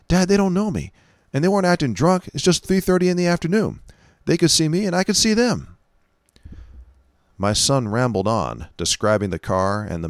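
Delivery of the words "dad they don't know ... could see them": higher, lightly voiced teenage boy; a hint of a shaken teenager; teen son voice.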